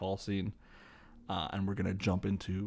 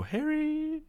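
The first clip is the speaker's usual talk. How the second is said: high, drawn-out mock scream at no added volume; mock screaming woman. Retelling the screaming woman calling for Harry, mock scream. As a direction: imitate a distant woman's scream, held high. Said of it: "heard the screaming woman go".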